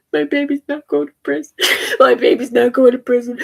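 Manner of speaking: tearful, voice breaking; sobbing out the words; crying. Real, not acted. Mother crying over jailed son.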